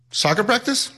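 high, surprised question from the coach. Retold or imitated. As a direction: coach can't believe he means football. Coach surprised by football request.

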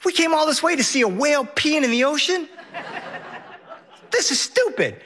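put-on high, sulky little boy voice; high-pitched kid griping about the whale; whiny kid. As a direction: complain like a bored child let down. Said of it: "Henry says".

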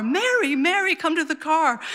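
high-pitched imitation of calling from afar; mock dad calling to his wife; retold calling out. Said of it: "he calls for my mom from the car".

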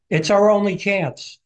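mild, high-pitched mock shout; imitated call over a distance; retold shout back. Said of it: "shouts back".

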